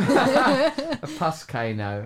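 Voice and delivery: slow giggly delight; laughing. Real, not acted. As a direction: savour the silly word through a laugh. Joking coinage, laughing.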